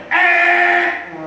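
high, loud mock game-show buzzer "eh"; buzzer noise. Preacher buzzing the congregation's wrong answer, mock buzzer. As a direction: buzz them out like a quiz show.

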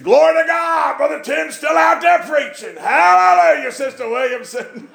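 high, loud imitation of a rejoicing preacher; jubilant praise shout. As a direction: holler it to the rafters, stretching the words out. Imitating Herbert rejoicing in the background.

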